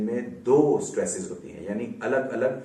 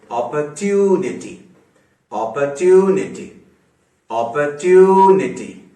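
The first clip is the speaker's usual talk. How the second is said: "Opportunity" said slowly and louder, with "tube" stretched longest; stress on tu; 8 dB louder, slower than usual. Shown as a demonstration. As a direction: draw out the "tube" of "opportunity", slow and loud. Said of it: "ट्यू सबसे ज्यादा खींचा तो ये primary stress".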